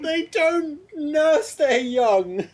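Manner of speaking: high, whining, near-crying rant; rising mock despair; exasperated rant. Real, not acted. Mock-distressed rant about ducks.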